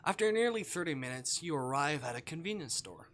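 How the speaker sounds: calm storybook read of the game text; game narration. Acted. Streamer reading visual novel text to chat, calm narration. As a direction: narrate the scene evenly to the viewers.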